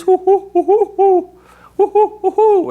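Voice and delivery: high hooting owl call, rhythmic and steady; owl hoot imitation. Lecturer showing birders the cadence of a far owl, high rhythmic hooting.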